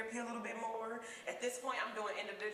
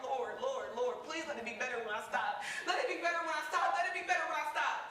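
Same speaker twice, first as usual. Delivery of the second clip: pleading prayer; 6 semitones higher, 4 dB louder than usual. Retold or imitated. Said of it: "dancing in church like".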